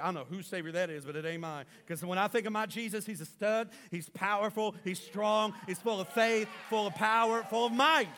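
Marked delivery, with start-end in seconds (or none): 1.9-8.1 loud preaching, building to a shout; building fervor. Real, not acted. Preacher proclaims his powerful Jesus to the church, rising fervor. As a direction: hammer out each phrase harder, higher pitched.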